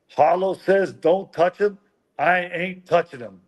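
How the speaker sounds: put-on Southern accent. Player imitates teammate answering a screaming coach, Southern accent. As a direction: protest in a high mock Southern voice. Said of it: "Grady has a little Southern accent".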